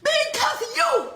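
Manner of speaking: furious shout. Real, not acted. Grieving mother screams at the defendant, crying in rage. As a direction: scream it at him through tears. Victim confronts defendant in court.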